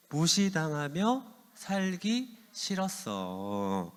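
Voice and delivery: drawn-out, deliberate reading of the title; slow title announcement. Shown as a demonstration. Pastor announces today's title to the youth, slow and deliberate. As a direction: slowly lay out the sermon's title.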